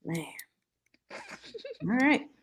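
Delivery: ordinary talk, nothing marked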